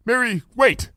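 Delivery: high urgent call to wait; urgent cry. Acted. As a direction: stop her in a hurry. Character cries out.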